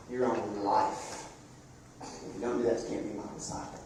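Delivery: from normal voice fading to a near whisper; hushed Jesus paraphrase. Acted. Preacher voicing Jesus to the crowd, fading quiet. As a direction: let the words of Jesus sink to a hush.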